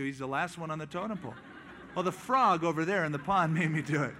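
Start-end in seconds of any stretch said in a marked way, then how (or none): 1.9-4.1 louder, chuckling through a silly excuse; laughing mock excuse. Snake blaming the frog, laughing at the absurd excuse. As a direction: pass the blame with a laugh.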